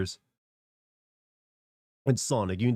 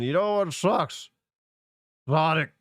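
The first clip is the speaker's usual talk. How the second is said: put-on slow, higher cranky old man; 6 semitones higher, 3 dB louder, slower than usual. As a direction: rant like a grumpy ninety-year-old. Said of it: "I will be like a ninety-year-old man".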